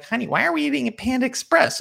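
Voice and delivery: wife asking why dinner is at Panda Express, mock high voice; wife's voice. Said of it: "She's gonna be like".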